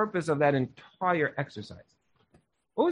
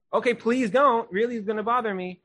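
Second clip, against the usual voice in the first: higher, quick pleading please; 6 semitones higher than usual. Retold or imitated. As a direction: beg him to stop, mildly. Avram begging Hashem to spare.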